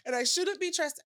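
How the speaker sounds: high-pitched comic despair; hammed-up rant at your own failings, half laughing; mock self-berating. Entrepreneur venting about failing herself, mock self-berating.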